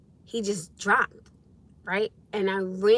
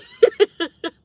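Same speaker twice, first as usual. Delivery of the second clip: loud, high laugh breaking out; high burst of laughter; 12 semitones higher, 10 dB louder than usual. Real, not acted. Still laughing at punchline.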